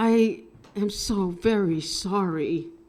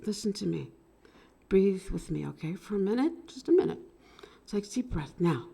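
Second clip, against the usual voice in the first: gentle calming; 9 dB quieter, faster than usual. Real, not acted. Breathing her through panic.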